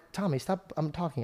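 ordinary delivery

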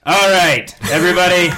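loud, high rallying call. Real, not acted. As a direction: call the whole room to order. Calling the group to attention.

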